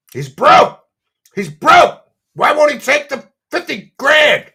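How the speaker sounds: high, loud mocking yell; shouting. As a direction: yell it out to mock him. Mocking Keemstar's clip, yelling.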